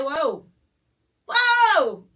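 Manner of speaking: jolted back in disbelief; stunned whoa. Retold or imitated. Reliving his sudden change of face, high stunned whoa. Shocked at boyfriend's sudden turn.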